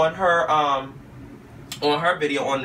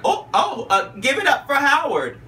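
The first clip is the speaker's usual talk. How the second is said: high, bright hype-man call; hyped host voice; 8 semitones higher than usual. Retold or imitated. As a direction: announce him like a host. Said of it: "and he was like".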